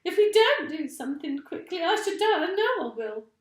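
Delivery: high, loud panicked scream. A narrator's reading. Missus Twit panics about the blood going to her head, high loud scream. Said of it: "screamed Missus Twit".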